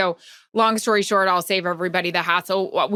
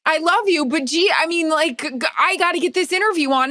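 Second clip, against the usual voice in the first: pleading; 8 semitones higher than usual. Retold or imitated. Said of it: "I'm like".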